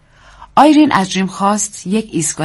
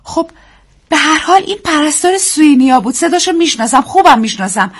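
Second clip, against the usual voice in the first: high, louder insistence; wife insisting she knows the nurse's voice; heated; 10 semitones higher, 5 dB louder than usual. A narrator's reading. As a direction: insist with heat. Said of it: "آیرین با حرارت گفت".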